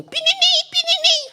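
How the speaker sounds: very high, loud pininim like a small bell; bell ringing. Retold or imitated. Preacher imitates the church bell, very high and loud. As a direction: sing the bell's ring. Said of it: "Então o sino".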